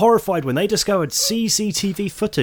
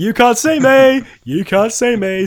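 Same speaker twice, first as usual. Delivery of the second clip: high, loud, drawn-out mock taunt; hammed-up slow, high taunting call; playful taunt; 9 semitones higher, 8 dB louder than usual. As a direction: call it out slowly like a hidden prankster. Plays housemate hiding under a duvet.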